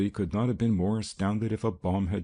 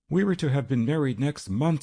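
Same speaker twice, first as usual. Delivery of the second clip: high, louder tearful line; sobbing bride-to-be, pitched up; 7 semitones higher, 4 dB louder than usual. A narrator's reading. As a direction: cry it out through tears. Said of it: "sobbed Eulalie Sandoval".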